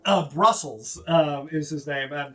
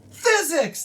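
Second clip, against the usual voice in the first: high, proud one-word blurt; high eager gnome; 13 semitones higher, 5 dB louder than usual. Acted. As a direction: pipe it up proudly. Gnome names his science.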